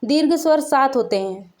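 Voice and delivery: normal talking voice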